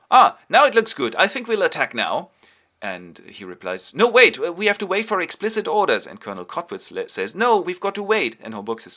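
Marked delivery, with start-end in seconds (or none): 0.1-2.3 loud, high-pitched enthusiasm; eager to attack. Retold or imitated. 3.9-6.1 loud, raised-pitch protest; urgent objection. Retold or imitated. Officer urgently holds him back. 7.4-8.4 loud, higher-pitched protest; insistent objection. Retold or imitated. Kottwitz objects to attack.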